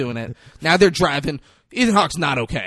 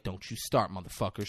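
lower, much softer threat; low warning; 6 semitones lower, 9 dB quieter than usual. Retold or imitated. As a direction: warn them under his breath. Denzel growling a warning.

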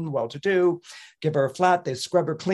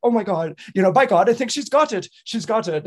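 rising, loud burst of astonished delight; amazed triumph; 6 semitones higher, 5 dB louder than usual. Acted. Teacher realising his pupil has got it, amazed. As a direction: build from awe to exclamation.

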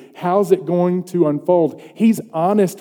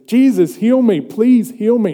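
higher, louder desperate plea; pleading cry; 6 semitones higher, 5 dB louder than usual. Retold or imitated. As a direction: beg like a blind man calling out. Blind beggar cries to Jesus.

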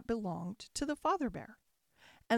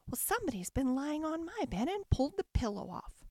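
lightly voiced mother bear, pitched up; a hint of a middle-sized bear voice; 5 semitones higher than usual. A narrator's reading. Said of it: "she said in her middle-sized voice".

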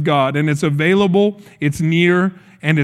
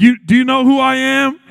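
raised-pitch superhero boast; cocky mock challenge, pitched up; flexing swagger; 7 semitones higher, 3 dB louder than usual. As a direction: show off to the crowd, rising challenge. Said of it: "just flex on them all".

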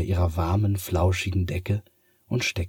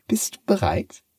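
much higher cat asking; a mysterious cat before the trick; 13 semitones higher than usual. Acted. Cat asks. (hohe Katzenstimme)

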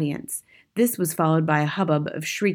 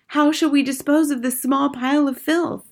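high witch crowd question; cried out; 9 semitones higher, 3 dB louder than usual. A narrator's reading. Witches asking how to dispose of boy, crying out. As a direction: ask eagerly in a high voice. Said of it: "they cried out".

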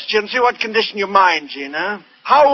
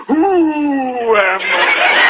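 amnesia patient; 3 semitones higher, much slower than usual. Retold or imitated. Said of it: "Now she says".

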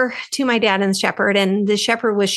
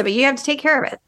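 ordinary talk, nothing marked

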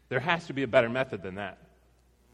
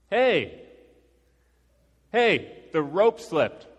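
loud, high call up to someone far above; shouted up; 6 semitones higher, 7 dB louder, much slower than usual. Retold or imitated. Climber to the guy above, shouting up. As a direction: shout the alarm up a cliff. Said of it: "I shouted up at him".

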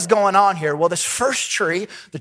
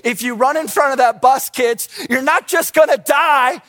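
higher and louder, building to an overblown "die, die"; warning the kids; 5 semitones higher, 4 dB louder than usual. Pretend. Parent warning kids about the bus, hammed-up and loud. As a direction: scare the kids with a hammed-up threat. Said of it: "This is like me telling my kids".

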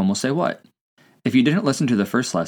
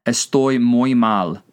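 slow, evenly spaced words; careful, drawn-out demonstration; slow language-teacher model; slower than usual. Shown as a demonstration. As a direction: say it slowly for learners to repeat. Teacher models phrase to translate.

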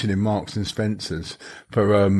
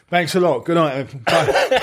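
higher, hammed-up goodbye; mock performer's sign-off; 5 semitones higher, slower than usual. Man leaving the pub like a stage act, mock sign-off. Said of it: "he came in and went".

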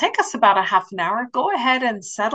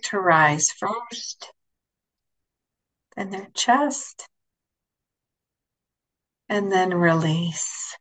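guided meditation. Acted. Leads a guided imagery meditation.

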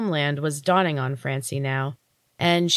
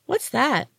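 put-on higher, eager little child asking; curious child voice; 8 semitones higher than usual. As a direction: point and ask like a nosy kid. Fairy child points at creature.

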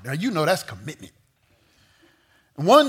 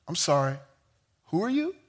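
puzzled rich man; 6 dB quieter, faster than usual. Retold or imitated. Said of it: "and he goes".

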